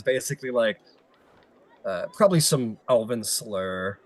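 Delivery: usual speaking voice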